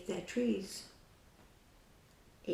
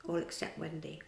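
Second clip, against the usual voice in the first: ordinary delivery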